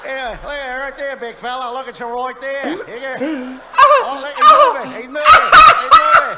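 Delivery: high, loud laughing taunts; mocking Aussie beachgoer. Beachgoers jeering at the comic in the water, loud laughing mock voice. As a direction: jeer like a rowdy beach crowd.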